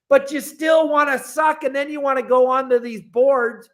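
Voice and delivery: loud, high rant; captain yelling at complainers online; shouting. Real, not acted. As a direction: yell it at the people who can't fish. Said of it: "ranting at bad fishermen".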